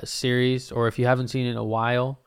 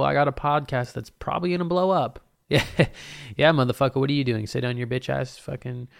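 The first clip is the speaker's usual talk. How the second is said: ordinary talk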